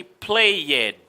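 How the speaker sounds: played as two beats, play-ed; wrongly voiced E. Shown as a demonstration. Teacher showing what never to say, played with an extra syllable. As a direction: sound the E in played, play-yed. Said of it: "So you never say".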